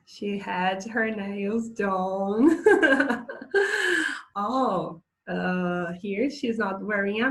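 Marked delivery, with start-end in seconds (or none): 2.7-4.3 high, loud giggling burst; amused laugh. Real, not acted. Teacher amused by freshly done nails in a picture, laughing.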